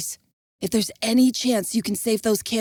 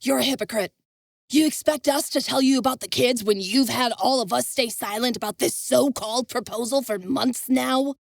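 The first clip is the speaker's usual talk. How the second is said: higher, accusing challenge; demanding; 5 semitones higher than usual. Acted. Devon confronting Envis, demanding. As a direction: call him a hypocrite to his face. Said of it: "Devon demanded".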